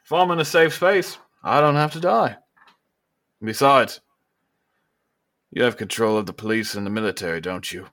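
high and loud, needling the rival; a politician scoring a point; smug governor. Acted. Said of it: "And he goes".

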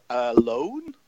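usual speaking voice

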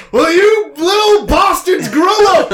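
loud, high, drawn-out yell; shout. Retold or imitated. Man flinging open the door at home, shouting. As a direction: bellow it slowly at the top of your voice. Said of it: "opens the door and shouts".

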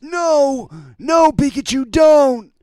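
high cartoon boy shout; Ash Ketchum voice. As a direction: cry out like an anime kid.

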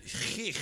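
"chich" rasped in the throat; mock German "chich"; harsh German ch. Shown as a demonstration. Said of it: "there's lots of".

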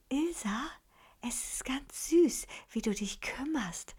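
whispered into her ear; breathy secret whisper; cupped hand whisper. Acted. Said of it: "flüsterte ihr ins Ohr".

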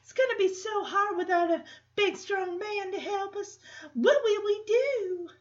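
high, sugary Southern belle, pleading; Southern belle drawl. Retold or imitated. Becky pretending she needs a strong man, Southern drawl. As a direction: ham up the helpless Southern belle. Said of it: "why is this Southern drawl coming from".

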